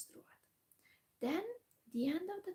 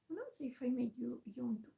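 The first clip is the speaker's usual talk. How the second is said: usual speaking voice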